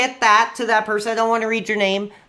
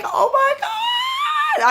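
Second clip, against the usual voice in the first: high, drawn-out mock wail; bawling fanboy; much slower than usual. Fan imagining meeting a childhood idol, bawling in hammed-up fanboy excitement. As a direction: squeal it like an overwhelmed fan. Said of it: "I would bawl my freaking eyes out".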